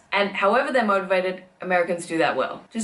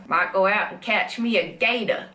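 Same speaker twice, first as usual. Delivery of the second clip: Southern accent. Shown as a demonstration. Showing off American accents, Southern gator-hunter accent.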